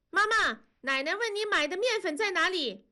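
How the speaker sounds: 尖高的童声喊叫; 小女孩扯着嗓子传话; 孩子喊妈妈. A narrator's reading. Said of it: "燕妮就喊". (燕妮学奶奶的话喊妈妈，高亮童声)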